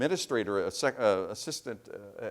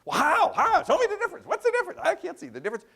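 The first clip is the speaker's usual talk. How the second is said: much higher and louder, pressing hard for an answer; a pushy New Yorker demanding how, much higher; agitated journalist; 9 dB louder, faster than usual. Retold or imitated. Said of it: "she's getting real agitated".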